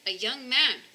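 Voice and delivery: ordinary delivery, nothing marked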